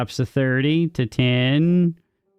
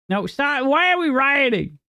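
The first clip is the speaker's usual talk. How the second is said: high-pitched, exasperated plea; mock dismay; 11 semitones higher than usual. Streamer begs chat to stop rioting, mock dismay. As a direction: plead with them in a raised pitch.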